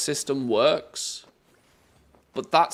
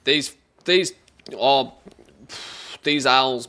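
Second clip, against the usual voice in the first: exasperated outburst; 8 dB louder, slower than usual. Real, not acted. Exasperated at owl puzzles.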